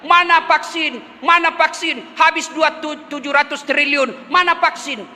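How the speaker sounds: ordinary talk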